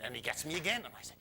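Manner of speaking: usual speaking voice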